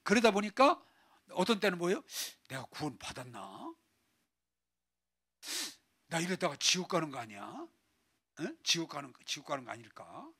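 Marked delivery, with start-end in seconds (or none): 2.5-10.3 low, subdued worry; fearful doubt. Acted. Believer doubting their salvation, mildly fearful self-questioning. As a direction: wonder softly, a touch of dread. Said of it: "막 두려운 거예요".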